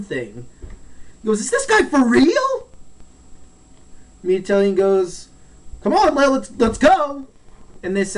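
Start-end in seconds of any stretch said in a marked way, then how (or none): 1.4-2.7 high, incredulous outburst; acted disbelief. Retold reaction to brutal fight. 5.8-7.3 high, loud urging; acted urgent call. Retold urging to escape.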